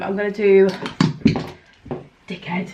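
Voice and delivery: ordinary talk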